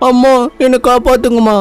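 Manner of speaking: put-on high pleading little girl's voice; small daughter sobbing into the phone to her mother; crying child. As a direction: beg mother for rescue like a weeping child.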